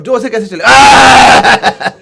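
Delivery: loud, slow roaring yell; demo shout. Shown as a demonstration. Preacher showing the crowd how loudly he roared, full shout. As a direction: roar it like pulling a plough. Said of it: "जोर से चिल्लाते हैं".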